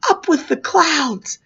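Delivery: ordinary talk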